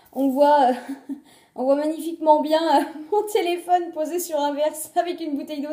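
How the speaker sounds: laughing. Real, not acted. Vlogger joking about her phone propped on a glass, laughing. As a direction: talk through your own giggles. Amused by her makeshift camera setup.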